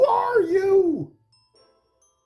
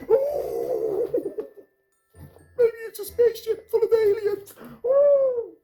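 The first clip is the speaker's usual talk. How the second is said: louder panicky worrywart; 7 dB louder than usual. Acted. Worrywart imagining danger, loud frantic squeak. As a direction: build the panic up.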